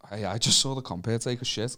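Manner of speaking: hushed, offhand mock report; low aside. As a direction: drop right down as if quietly confiding. Imagined casual remark, voiced quietly.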